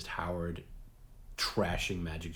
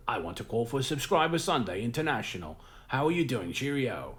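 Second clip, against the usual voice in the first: higher, hammed-up fake English accent; bad English accent; 5 semitones higher, 4 dB louder than usual. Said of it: "they'll just do a really bad, like, accent".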